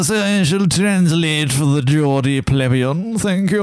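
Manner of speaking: slow posh English voice; mock posh, slow and lofty; posh accent. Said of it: "be a bit more posh".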